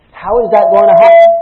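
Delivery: high, loud panicked question; anxious outcry. Retold or imitated. Worrier panicking over God's command, loud high outcry. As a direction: cry out in worried disbelief. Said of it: "That's concern".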